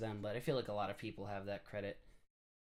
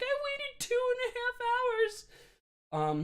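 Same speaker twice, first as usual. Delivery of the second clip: very high, very slow whining wail; tearful kid protest; 5 dB louder, much slower than usual. Retold or imitated. Crying kid protesting to the ride operator, drawn-out wail. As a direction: drag out the complaint like a crying kid. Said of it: "I'm like".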